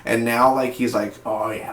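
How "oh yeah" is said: lightly voiced cartoon villain; a hint of a cartoon baddie. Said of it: "talking like he's a freaking villain".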